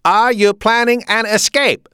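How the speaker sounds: put-on loud, high German-accented demand. A narrator's reading. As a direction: interrogate forcefully as a German officer. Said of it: "and demanded".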